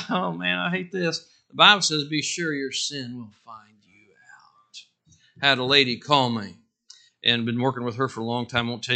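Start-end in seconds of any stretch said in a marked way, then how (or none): 2.0-4.8 slow, hushed whisper of a warning; whispered verse. Acted. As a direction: breathe the verse out slowly, like a secret. Quoting Bible verse for effect.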